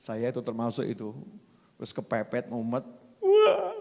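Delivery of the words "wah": high, drawn-out fake bawl; acted sudden sob; mock crying wail. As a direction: burst out in an exaggerated wail.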